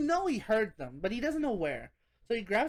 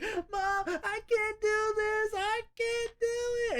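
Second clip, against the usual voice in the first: high, slow mock sob; imitating Karen fake crying for help; 12 semitones higher, much slower than usual. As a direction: whimper it like a pretend victim. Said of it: "She's fake crying".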